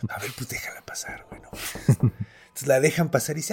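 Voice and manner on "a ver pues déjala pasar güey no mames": scared gods whispering; hushed panic behind the door. Retold or imitated. (susurro asustado)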